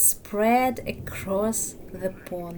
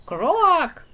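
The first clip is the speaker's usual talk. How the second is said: loud, high croak; frog chorus scream; 6 semitones higher, 6 dB louder than usual. Acted. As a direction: shout it like a whole pond. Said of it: "All the frogs screamed".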